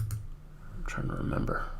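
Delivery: near-whispered thinking aloud; muttering to self. Real, not acted. Streamer still trying to recall the unit, muttered to self. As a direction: mumble it very softly to yourself.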